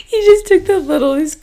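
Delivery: words broken by real laughter; laughing. Real, not acted. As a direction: laugh through it, high. Laughing at husband's tiny bite.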